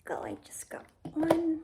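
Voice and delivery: whisper. Acted. Gentle puppet play narration.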